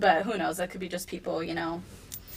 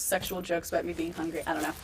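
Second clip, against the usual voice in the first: ordinary talk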